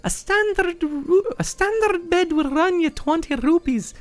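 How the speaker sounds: high-pitched character greeting; perky innkeeper. Acted. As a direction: welcome guests in a squeaky sales-pitch voice. Voices the inn's host.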